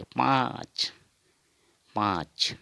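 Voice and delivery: पाँच with a long vowel through the nose; nasal pronunciation. Shown as a demonstration. Teacher repeating the Assamese five, nasal vowel. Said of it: "नाक से उच्चारण होगा".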